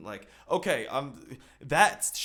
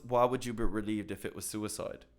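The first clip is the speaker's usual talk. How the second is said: ordinary delivery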